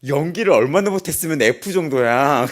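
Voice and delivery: loud, incredulous mock professor; mock examiner's loud jab; interviewing professor imitated. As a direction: raise the voice as a scornful examiner. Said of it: "교수님이 저한테 면접 볼 때 뭐랬는지 알아요".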